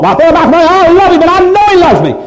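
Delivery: high mocking voice of a doubting listener; mocking whiny churchgoer. Preacher mimics a doubter's excuse to his congregation, mocking high voice. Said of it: "you can say to me all you want".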